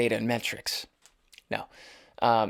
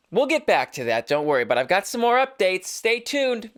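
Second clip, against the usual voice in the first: higher, louder show-host patter; mock announcer; 8 semitones higher, 4 dB louder than usual. Host teasing more updates to viewers, mock announcer. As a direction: hype it up like a hammy presenter.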